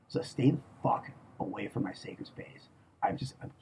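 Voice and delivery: soft, hissed menacing whisper; threatening whisper. Real, not acted. Whispering a warning to stay away from his sacred space. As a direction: drop to a whisper to warn them off.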